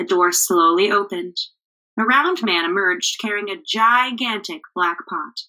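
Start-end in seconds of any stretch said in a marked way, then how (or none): none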